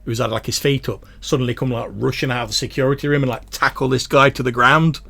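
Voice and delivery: usual speaking voice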